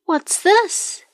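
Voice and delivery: high, rising startled question; puzzled discovery. A narrator's reading. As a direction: ask it high, like a curious little bird. Kiwi spots a strange animal.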